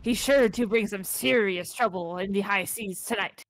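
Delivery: hammed-up pirate captain announcing a brawl; terrible pirate accent. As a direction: put on a rolling pirate accent like a fight announcer. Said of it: "forgive me for my terrible pirate accent".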